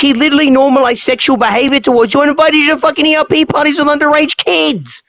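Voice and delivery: indignant anger on the listener's behalf; outraged. Real, not acted. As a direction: demand it in disbelief. Outraged at the groomer's denial.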